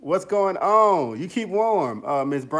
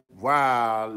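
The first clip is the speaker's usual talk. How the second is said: normal talking voice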